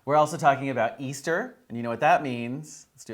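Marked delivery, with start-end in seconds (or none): none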